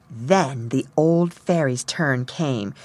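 ordinary delivery, nothing marked

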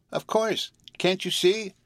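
very high little cat voice; small kitten voice. A narrator's reading. Kitten answering the girl's question, in a tiny high voice. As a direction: squeak it lightly as a kitten. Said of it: "the small voice of the kitten".

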